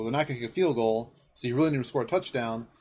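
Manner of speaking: ordinary talk, nothing marked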